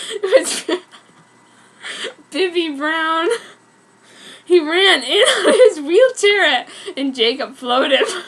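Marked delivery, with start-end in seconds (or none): 0.1-6.7 laughing. Real, not acted. Reader laughs through absurd line.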